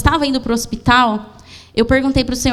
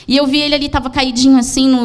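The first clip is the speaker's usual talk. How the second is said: ordinary delivery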